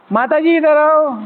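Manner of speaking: high, drawn-out mock teacher call; teacher sister's tone. Teacher sister calling a mataji over, high drawn-out imitation. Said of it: "ऐसी teacher बहनों की अलग tone".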